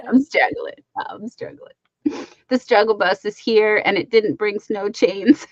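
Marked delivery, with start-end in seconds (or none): 1.1-1.7 soft breathy whisper. Real, not acted. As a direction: whisper it to yourself. Tired streamer repeats in whisper.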